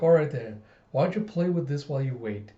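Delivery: ordinary delivery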